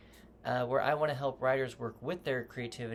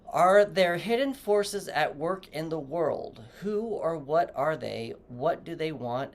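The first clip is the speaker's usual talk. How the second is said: slow, louder questions posed to the writer; dramatic prompt reading; 2 semitones higher, 5 dB louder, slower than usual. Acted. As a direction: read it like an ominous story hook. Reads writing prompt off card.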